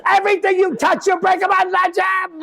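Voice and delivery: high shouting Italian dad, furious; father blowing up at his son, shouting in a high Italian voice; ballistic father. Retold or imitated. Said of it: "my father goes ballistic".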